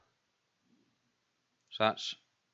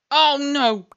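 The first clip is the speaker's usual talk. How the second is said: loud, high cry of dismay; dismayed shout; 14 semitones higher, 18 dB louder than usual. Real, not acted. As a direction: shout it out in sudden horror. Sudden disaster in the game.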